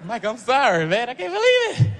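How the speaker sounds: high, laughing apology; starstruck fan. Acted. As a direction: giggle out a sorry to your hero. Fan character apologizing to Michael.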